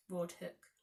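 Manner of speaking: ordinary delivery, nothing marked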